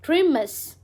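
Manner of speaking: ग्रीमस with the stress on GRI; pronounced grimace. Shown as a demonstration. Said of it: "कैसे?". (ग्रीमस का उच्चारण)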